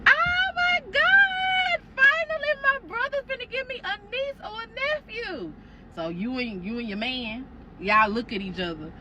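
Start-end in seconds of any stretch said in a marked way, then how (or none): 0.1-5.5 very high, drawn-out squeal of joy; bubbly excitement. Retold or imitated. Sister-in-law meets the pregnant girlfriend, squealing with joy. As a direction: gush like a thrilled aunt. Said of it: "she was very bubbly".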